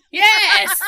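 loud, high whoop through real laughter; laughing. Real, not acted. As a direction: burst out laughing in agreement. Cracking up at friend's reference.

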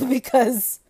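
loud nervous laughter. Real, not acted. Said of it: "that's a nervous laugh".

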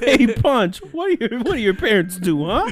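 hammed-up busybody grilling the taco guy; mock interrogation. Mock-teasing the taco seller.

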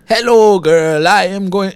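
put-on clumsy Italian Pinocchio-style accent, half-hearted; bad Italian accent. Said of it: "Italian Pinocchio accent".

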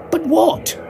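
very high bewildered sidekick; helpless question. A narrator's reading. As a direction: ask in a squeaky panic. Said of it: "he asked".

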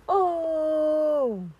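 high wailing oh; a sudden pained wail; anguished cry. Retold or imitated. Wailing to herself about loneliness.

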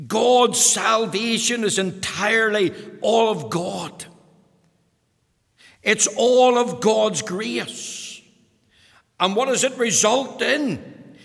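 fervent preaching. Acted. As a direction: preach it out to the congregation. Preaching at full force.

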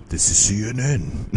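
badly faked network announcer voice; half-hearted, slightly high announcer impression; James Earl Jones. Fan jokingly attempts a famous network tagline, bad impression. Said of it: "James Earl Jones impression ever".

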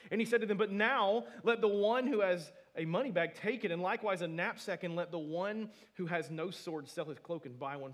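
loud, high fervent preaching; booming pulpit proclamation; preaching at full force. Real, not acted. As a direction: cry it out to the congregation.

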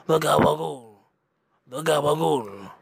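gabagool in a hammed-up New Jersey Italian accent; mock Italian-American accent. Mocking The Sopranos food talk, hammed-up New Jersey Italian accent. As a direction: stretch out the gabagool like a Sopranos mobster. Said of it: "mock Italian pronunciation".

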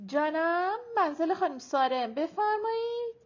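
put-on high phone voice, stretched out; high, drawn-out. A narrator's reading. Ayeh answering the house telephone, high and drawn-out. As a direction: answer the phone high and loud, drawling to a rising end. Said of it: "با صدای زیر و کشدار".

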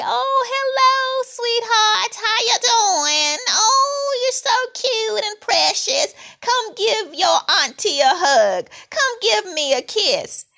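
put-on high, sugary auntie cooing at a child; chatty teasing aunt. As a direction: gush over the kid like a fake-sweet aunt. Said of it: "and grin and say".

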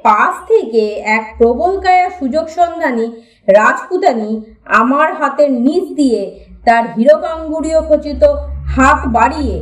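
ordinary delivery

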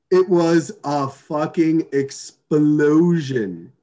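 very slow, drawn-out words; dramatic emphasis. Real, not acted. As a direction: stretch out each word for impact. Dramatic punchline of the story.

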